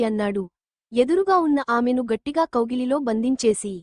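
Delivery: usual speaking voice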